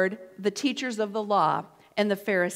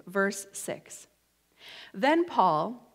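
normal talking voice

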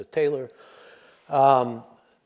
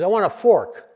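higher, louder, tough New York guy demanding; tough New Yorker; 7 semitones higher, 7 dB louder than usual. Retold or imitated. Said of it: "He says".

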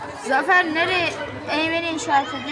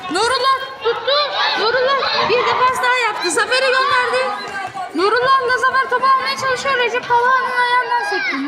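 excited commentator; 7 semitones higher than usual. Acted. Fast play, high excited calls.

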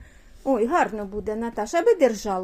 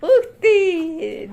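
surprised; 5 semitones higher, 6 dB louder than usual. Real, not acted. Surprised exclamation at pet.

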